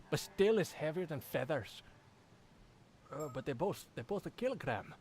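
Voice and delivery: Scottish meme impression; Scottish accent. Gamer acting out the kilogram meme, Scottish accent. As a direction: puzzle it out in a mock Scottish accent. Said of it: "you recognize that meme".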